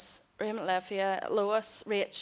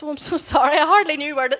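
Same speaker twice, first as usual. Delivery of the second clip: higher, louder, flustered sorry; flustered apology; 5 semitones higher, 10 dB louder than usual. Retold or imitated. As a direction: blurt the apology out, shaken. Apologising, standing on his home.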